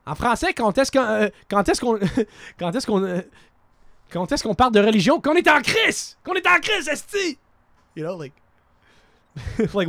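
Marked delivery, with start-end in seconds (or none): 5.2-7.4 high, loud Quebec swearing like you're pissed off; mock French-Canadian cursing. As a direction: spit out the French-Canadian curse. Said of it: "literally, when I'm pissed off".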